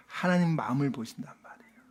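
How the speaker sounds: soft, breathy whisper to the congregation. Real, not acted. Preacher whispering to the congregation that God sees the heart. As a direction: lean in and whisper it like a secret.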